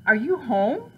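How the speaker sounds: loud, high call at the door. Acted. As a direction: holler to see who's in. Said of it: "someone yelled".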